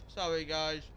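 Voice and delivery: muffled by a hot bite; mouth full of hot food. Real, not acted. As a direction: apologise while still chewing. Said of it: "I'm still eating".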